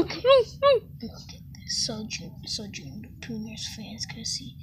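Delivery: unvoiced sneaky aside; whispering. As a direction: whisper it to the audience. Aside to the audience.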